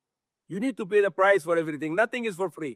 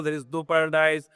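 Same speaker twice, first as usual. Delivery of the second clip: normal talking voice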